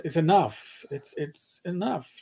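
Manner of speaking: ordinary talk, nothing marked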